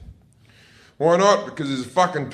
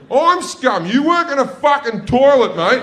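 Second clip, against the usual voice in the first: high, loud mock outrage; indignant comeback to the toilet attendant; indignant retort; 10 semitones higher, 5 dB louder than usual. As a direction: snap back at the attendant.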